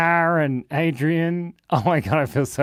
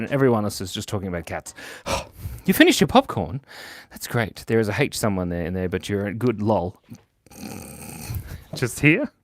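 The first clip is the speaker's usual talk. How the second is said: ordinary delivery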